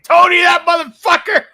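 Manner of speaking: angry shout. Retold or imitated. Quoting the Cap's outburst.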